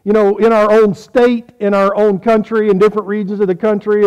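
ordinary talk, nothing marked

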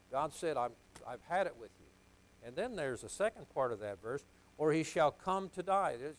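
loud, emphatic sermon delivery; forceful preaching. Real, not acted. Preacher reading a startling verse to his congregation, forceful preaching. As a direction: preach it out, punching key words loudly.

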